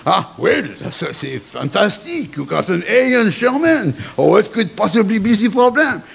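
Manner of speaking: put-on puzzled praise in a French accent. Headmaster admires student's German grade, in a French accent.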